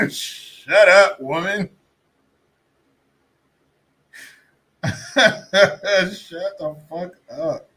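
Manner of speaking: loud, laughing shut-up; laughing outburst. Real, not acted. Mocking a politician's video, loud laughing outburst. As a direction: blurt it through laughter, half yelling.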